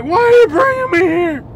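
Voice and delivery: high, loud mock wail; hammed-up hysterical question; imitating freaked-out passenger. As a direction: demand it like a panicked rider. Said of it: "she just freaked the crap out".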